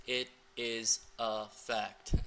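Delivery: very slow, separated words; one word at a time. Retold or imitated. Coach reading out her chopped-up texts, word by word. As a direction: space out each word like separate messages. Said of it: "typing one message, one word at a time".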